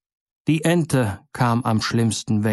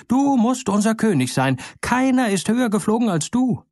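birds calling out; 9 semitones higher than usual. A narrator's reading. Birds call up to eagle.

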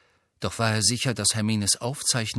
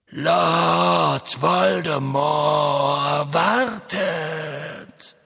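cold villain voice; 5 semitones higher, 6 dB louder, much slower than usual. A narrator's reading. Villain speaks to prisoner.

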